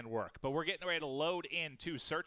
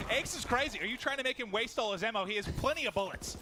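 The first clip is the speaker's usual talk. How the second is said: excited shout, much higher and louder; 9 semitones higher, 5 dB louder than usual. Real, not acted. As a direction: caster losing it at a crazy play. Caster hyped by wild play.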